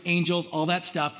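normal talking voice

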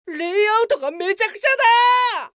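shocked cry. Acted. Shock at broken website layout.

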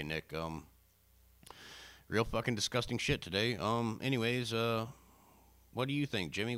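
usual speaking voice

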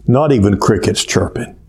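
usual speaking voice

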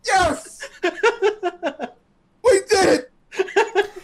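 high, laughing triumphant cheer. Real, not acted. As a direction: celebrate through laughter. Cheers at topic of dicks.

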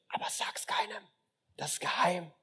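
hushed, breathy aside; secret whisper. Acted. Jesus whispering a secret to his listeners. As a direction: whisper it like a secret no one may hear.

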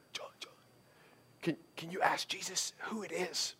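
urgent hushed whisper to a neighbor; secretive whisper. Acted. Peter privately asking John to ask Jesus, secretive whisper. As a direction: whisper it so only he hears.

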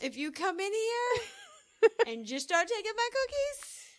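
mock warning. Acting cookie owner.

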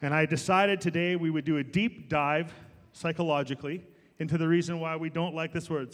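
usual speaking voice

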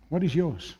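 ordinary talk, nothing marked

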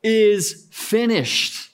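high, drawn-out dramatized dying gasp; gasped last words. Retold or imitated. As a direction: push out each word slowly, as if suffocating. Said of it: "he gasped with his lungs".

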